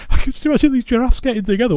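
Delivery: laughing through the words. Real, not acted. Vlogger setting up the giraffe joke, laughing. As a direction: rush it out while laughing.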